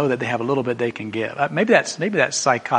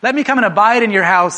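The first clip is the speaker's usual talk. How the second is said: calling up the tree; 7 semitones higher, 7 dB louder than usual. Acted. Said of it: "looks up at him and says".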